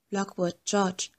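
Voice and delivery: faint, slow call from far away; muffled distant shout, soft and slow. Acted. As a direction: call the names as if from far down the building. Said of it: "Von fern hörte ich jemanden nach uns rufen".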